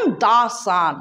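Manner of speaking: "the sun" said very slowly, with "the" stressed; slow, stressed teaching example; emphatic the. Shown as a demonstration. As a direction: lean on "the" as you say "the sun". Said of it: "যখন জোর দিয়ে বলি".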